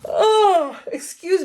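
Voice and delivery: a big real yawn that breaks into a high sound. Real, not acted. Late at night talking through scrapbook pages, yawning. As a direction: let a real yawn escape on the word. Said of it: "I'm yawning".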